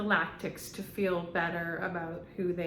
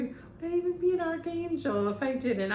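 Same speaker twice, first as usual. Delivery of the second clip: higher, fast, put-on theatrical voice; dramatic diva voice; 8 semitones higher, faster than usual. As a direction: fling it off like a wounded diva. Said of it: "That's how he".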